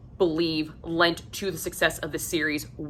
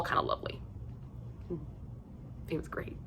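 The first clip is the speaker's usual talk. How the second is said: giggly whisper; 16 dB quieter, faster than usual. Real, not acted. Teasing about a sexy scene.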